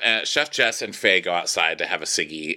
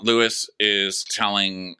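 ordinary talk

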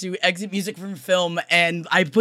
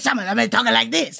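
Italian accent; 4 semitones higher, faster than usual. Shown as a demonstration.

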